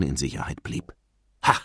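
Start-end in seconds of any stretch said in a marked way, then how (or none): 1.4-1.6 short exclamation; calling out. A narrator's reading. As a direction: pounce on a sudden excuse. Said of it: "rief er". (kurzer Ausruf)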